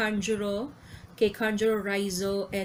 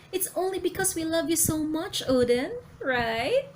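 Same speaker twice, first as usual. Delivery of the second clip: lovey-dovey wife; 8 semitones higher than usual. Acted. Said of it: "lovey-dovey".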